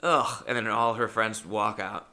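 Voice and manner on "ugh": slow, louder let-down complaint; disappointed fan. Retold or imitated. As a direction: sound let down by him. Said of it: "And she goes".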